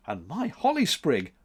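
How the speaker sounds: plain reading voice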